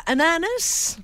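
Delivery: "Ananas" with an English ending, rising like a question; "Ananas" pronounced anana-ness, hopeful rise; Anglicized ananas. Retold or imitated. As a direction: ask "Ananas" as if it were English. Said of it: "tu m'as demandé".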